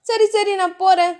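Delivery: put-on flustered young wife; shy heroine. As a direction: brush him off shyly, voice lifted. Heroine Dhiya voiced higher.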